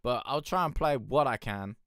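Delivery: ordinary delivery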